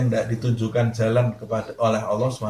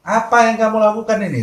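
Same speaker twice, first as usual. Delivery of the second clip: much higher and louder, startled; alarmed question; 10 semitones higher, 7 dB louder than usual. Retold or imitated. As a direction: jump up in alarm at the confession. Ustaz kaget bertanya. (kaget, suara tinggi dan keras)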